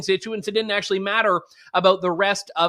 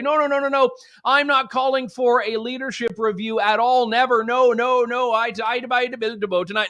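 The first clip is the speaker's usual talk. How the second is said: flustered backpedalling in a put-on Newfoundland accent, higher; hammed-up Newfoundland accent, flustered and higher; mock Newfoundland accent; 5 semitones higher than usual. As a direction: slip into a Newfie accent, panicky denial. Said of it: "No, sorry, I said I wouldn't do it".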